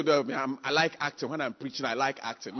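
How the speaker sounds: low, soft mocking mumble; mock excuse. Preacher mocking a member who prefers acting, in a soft mumble. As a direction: imitate a lazy believer, muttered.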